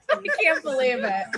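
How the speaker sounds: breaking up laughing, voice half sobbing; laughing hard. Real, not acted. Fan overcome with laughter at the reunion clip. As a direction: laugh until it nearly turns to tears. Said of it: "I'm laughing so hard".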